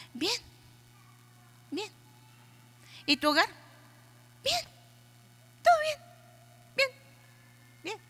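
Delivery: high, chirpy bright answers; fake cheerful wife. Pastor mimicking wives who pretend all is fine, high chirpy replies.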